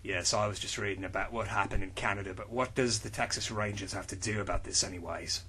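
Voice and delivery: low, soft gravel of a tough hero; low, quiet tough-guy voice; gruff hero voice. Acted. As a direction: read it like a brooding action hero. Reads character lines in low voice.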